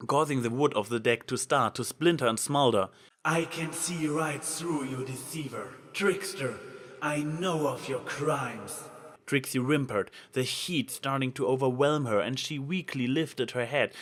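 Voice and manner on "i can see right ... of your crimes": slow, ominous divine judgement; accusing goddess. A narrator's reading. Goddess exposing Trixie's crimes, slow ominous accusation. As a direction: weigh each word like a verdict.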